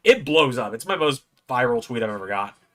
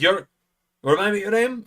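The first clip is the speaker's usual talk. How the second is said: Joe Hendry; 9 semitones higher, 4 dB louder than usual. Retold or imitated. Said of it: "not the best Joe Hendry impression".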